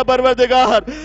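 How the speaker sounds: a loud prayer breaking into weeping; tearful prayer. Acted. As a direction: pray to the Lord through tears. Imam Sajjad prays for mother. (روتے ہوئے بلند آواز میں دعا)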